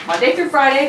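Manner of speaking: ordinary talk, nothing marked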